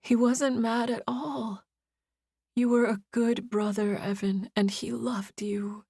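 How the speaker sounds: slow, teary, dropping into a whisper; tearful comfort. A narrator's reading. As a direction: comfort him through tears. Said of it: "he sobbed".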